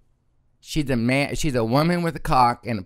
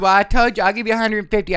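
a higher, louder pushy bargaining trick; mock pleading trick; 8 semitones higher, 5 dB louder, faster than usual. Trick calling after her offering more money, pitched up and pushy. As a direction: play the trick raising his offer, pitched up.

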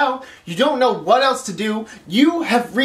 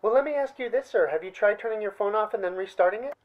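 mock support agent; 2 semitones lower, 9 dB quieter, faster than usual. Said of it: "they always hit you with the same line".

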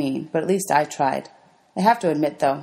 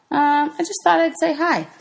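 high, hesitant little voice; nervous girl offering a shy hi; timid hello; 12 semitones higher than usual. Acted. Girl nervously greets the bully, high and timid.

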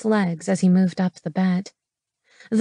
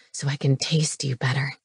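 low, soft man's voice; hushed, low-voiced romantic hero; male lover; 6 semitones lower, 5 dB quieter than usual. A narrator's reading. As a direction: drop pitch and volume, intimately.

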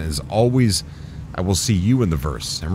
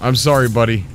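ordinary talk, nothing marked

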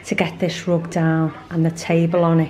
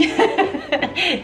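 high laugh; 11 semitones higher than usual. Real, not acted. Laughs at own insistence.

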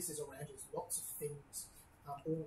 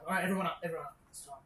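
gruff, loud but not yelling; gruff middle-aged man; 7 dB louder, faster than usual. Acted. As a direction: call the crew up, gruff and firm. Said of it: "you hear a gruff voice".

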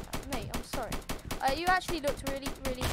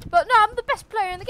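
loud, higher mock-cocky bragging; hammed-up loud gloating boast; 8 semitones higher, 10 dB louder than usual. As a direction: puff up like the best player alive. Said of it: "I'm getting a bit cocky".

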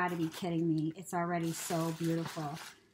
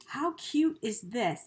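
ordinary talk, nothing marked

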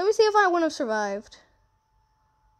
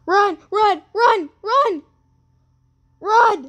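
panicked yelling, much higher and louder; being chased; 8 semitones higher, 8 dB louder, slower than usual. Real, not acted. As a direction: yell at them to run. Fleeing the rising lava.

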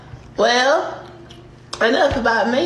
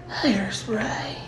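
whispering; 3 semitones lower, 7 dB quieter than usual. Real, not acted. Whispered word.

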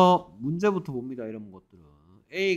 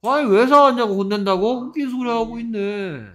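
high, incredulous scolding; exasperated scoffing; 7 semitones higher, 6 dB louder than usual. Real, not acted. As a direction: scoff at an absurd answer. Teacher scoffs at students' answer. (어이없다는 듯 높은 목소리로 타박)

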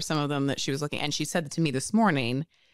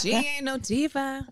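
high imitation with laughter around it; War; 9 semitones higher than usual. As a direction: pitch the catchphrase high, playfully mimicking. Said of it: "he would also do".

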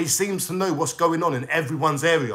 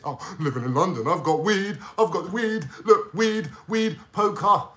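lightly voiced mocking of a boastful troll. A vlogger mimics the troll bragging about weed, lightly put-on voice. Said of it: "when I message him, there's no talk of, no gangster talk there".

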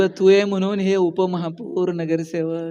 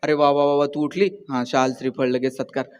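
a lightly voiced sugary flatterer, lower; mock gushing praise; 7 semitones lower, 4 dB quieter than usual. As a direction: lower and softer, sarcastically over-sweet. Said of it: "रोज उठल्याबरोबर".

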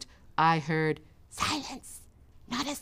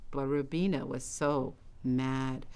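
usual speaking voice

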